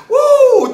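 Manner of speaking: loud, high "woo" of joy; elated whoop. Real, not acted. Preacher rejoices over an anointed poem, elated whoop. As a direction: let out a piercing, exultant whoop. Overjoyed after reading the poem.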